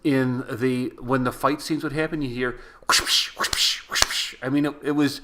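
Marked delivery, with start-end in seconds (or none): 2.9-4.3 breathy whoosh noises; whoosh sound effects. Retold or imitated. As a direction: imitate cheap movie swooshes. Imitating fight sound effects.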